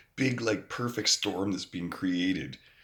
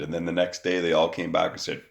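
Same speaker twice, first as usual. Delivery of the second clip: ordinary talk, nothing marked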